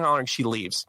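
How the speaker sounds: ordinary talk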